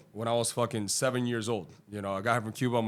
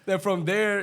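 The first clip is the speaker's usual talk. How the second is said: laughing; 10 semitones higher, 6 dB louder, slower than usual. Real, not acted. Laughing at own cluelessness.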